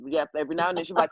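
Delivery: normal talking voice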